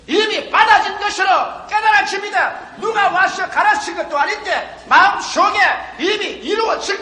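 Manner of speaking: climbing to a high, loud sermon peak; impassioned pulpit shout, building; full-force preaching. Real, not acted.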